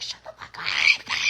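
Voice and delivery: strained, slow growl of pent-up frustration; acted exasperated outburst. Mom at her limit with a crying kid, acted angry outburst. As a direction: let the pent-up annoyance burst out. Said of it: "energy in your body where you're like".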